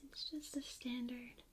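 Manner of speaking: ordinary talk, nothing marked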